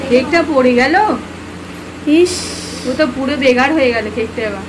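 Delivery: loud, put-on shock that the cake fell; mock dismay. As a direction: scold the students as if truly upset. Fake-angry teacher in cake prank. (ভান করা রাগে জোরে চিৎকার)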